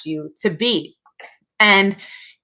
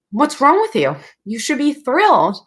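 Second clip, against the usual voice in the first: puzzled husband; 6 semitones higher than usual. Retold or imitated. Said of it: "And he's like".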